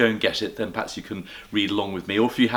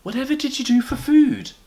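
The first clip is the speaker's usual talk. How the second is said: amazed Mrs Brown; 5 dB louder than usual. Acted.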